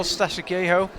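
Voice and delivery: usual speaking voice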